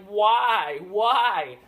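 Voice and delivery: loud, high cry of disbelief; high, exasperated yelling; exasperated outcry. Retold or imitated. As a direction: wail it in mock despair. Exasperated retort to rushed action scenes.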